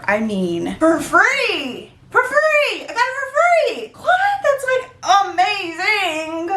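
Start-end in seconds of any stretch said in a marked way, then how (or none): 0.8-4.2 real high-pitched excited outburst; thrilled squeal. Real, not acted. Thrilled at getting it free. 4.4-6.5 amazed. Real, not acted. Amazed at the free score.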